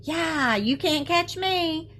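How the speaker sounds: high, slow singsong taunt; taunting yell. A narrator's reading. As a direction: tease him to chase you.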